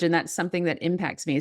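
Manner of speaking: ordinary talk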